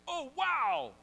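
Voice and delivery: high, loud burst of wonder; big mock-awed wow; amazed exclamation. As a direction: blurt it out in acted amazement. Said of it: "they're like".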